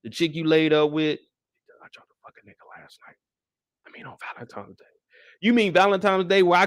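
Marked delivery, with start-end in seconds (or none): 1.8-4.8 fast breathless whisper; fast sheepish whisper; whispered mock confession. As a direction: whisper it like a guilty secret. Imitating the girlfriend confessing.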